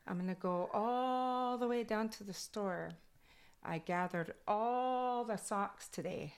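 Kwakwala-accented English with a long, high ALL. Shown as a demonstration. Showing how Kwakwala goes into English, accented and drawn out. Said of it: "my accent from Kwakwala goes into English".

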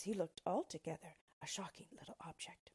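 plain reading voice

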